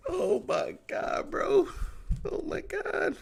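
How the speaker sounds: high squeaky helpless laughter through the words; laughing. Real, not acted. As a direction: laugh so hard the words barely come out. Laughing helplessly at his team.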